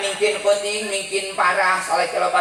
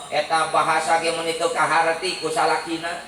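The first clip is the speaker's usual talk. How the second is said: normal talking voice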